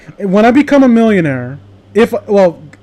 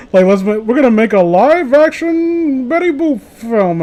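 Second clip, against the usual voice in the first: slow, higher mock pitch voice; hammed-up Hollywood exec pitching a remake; mock studio executive; 6 semitones higher than usual. Mocking Hollywood while chatting with friends, hammed-up exec voice.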